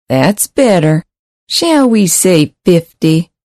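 slow, braying nasal drawl; a hint of a sleazy nasal pimp. A narrator's reading. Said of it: "The pimp continued".

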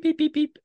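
high loud beeping like an alarm; imitated alarm beeps. Joking about a wake-up alarm, high beeping. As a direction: beep it out high like an alarm clock. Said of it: "alarm clock that instead of going beep".

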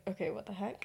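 hushed annoyance. Retold or imitated. Hushed late-night common room exchange.

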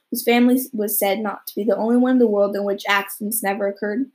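ordinary delivery

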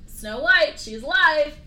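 loud, high mock announcement; hammed-up loud mirror; mirror's blunt news. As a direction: blurt it out like bad news. Mirror announces, queen furious.

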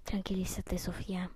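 whispered self-reassurance. Real, not acted. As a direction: whisper to calm yourself down. Calming herself after hearing steps.

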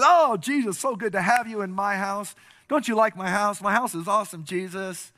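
high, loud, over-eager hosting; gushing host. Acted. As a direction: gush over your honoured guest. Dreamer gushes to his guest.

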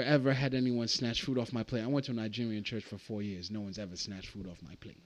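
normal talking voice

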